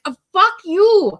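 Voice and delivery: high, loud, drawn-out outburst; exasperated retort. Retold or imitated. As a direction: fire back slowly and loudly at a lecture. Exasperated retort to relative's lecture.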